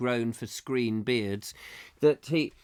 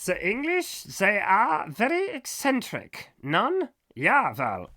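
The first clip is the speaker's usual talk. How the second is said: put-on slow, high-pitched comic German accent; exaggerated German accent, pitched high; 7 semitones higher, slower than usual. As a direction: draw it out as a baffled German sailor, accent laid on thick. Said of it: "in a German accent".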